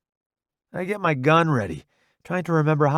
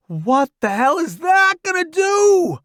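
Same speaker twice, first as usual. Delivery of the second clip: loud, high panicked yell; incredulous shout; 6 dB louder than usual. A narrator's reading. Newbie questioning his rescuer under bombing, incredulous shout. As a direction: shout it over the bombs. Soldier asks under falling bombs.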